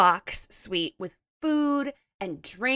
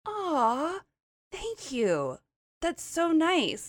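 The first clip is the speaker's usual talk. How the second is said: sweet thank you; 6 semitones higher, much slower than usual. Retold or imitated. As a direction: coo it kindly. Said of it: "She was like".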